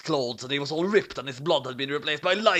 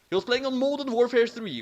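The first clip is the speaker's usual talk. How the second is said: ordinary delivery, nothing marked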